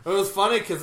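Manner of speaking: usual speaking voice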